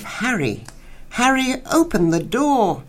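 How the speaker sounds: high, wailing plea for Harry; a woman crying out through a locked door, pitched high. Acted. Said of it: "but now a wail of".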